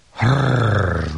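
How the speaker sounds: rolling monster growl. Acted. Monster growling at the animals.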